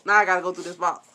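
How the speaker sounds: ordinary talk, nothing marked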